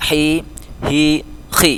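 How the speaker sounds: "Hi, hi, khi": sharp, rounded, then rasped in the throat; three contrasting h letters. Shown as a demonstration. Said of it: "di sini agak tajam".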